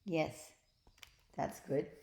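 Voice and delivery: plain reading voice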